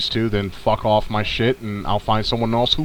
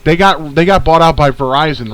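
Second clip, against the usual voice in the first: usual speaking voice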